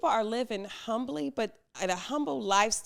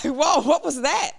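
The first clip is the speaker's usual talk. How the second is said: raised-pitch, louder mock alarm; retold scare; 6 semitones higher, 8 dB louder than usual. Speaker reliving her toilet scare, mock startled. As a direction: relive the startled shock, playfully. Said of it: "I was scared, though".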